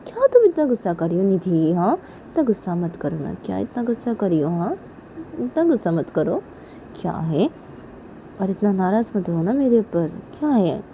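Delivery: hushed, placating voice; acted quiet coaxing; soft pleading. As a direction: gently beg an angry partner to calm down. Soothing an angry partner.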